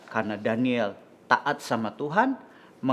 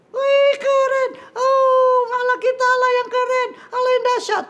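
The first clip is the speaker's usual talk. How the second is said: loud, very high squeaky puppet; thrilled high-pitched puppet; excited little puppet; 10 dB louder than usual. Acted. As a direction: squeal with delight in a tiny voice. Puppet cheers the decree.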